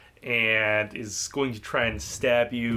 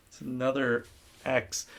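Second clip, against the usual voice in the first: ordinary talk, nothing marked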